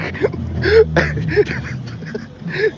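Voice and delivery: high helpless laugh; breathless high-pitched laughing; real laughter. Real, not acted. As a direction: crack up for real. Speaker cracking up.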